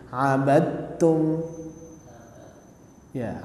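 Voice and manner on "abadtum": slow Abadtum, doubled d pressed hard; stressed tasydid. Shown as a demonstration. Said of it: "Abadtum ditekan karena ada tasydid". (tekan tasydid pada Abadtum)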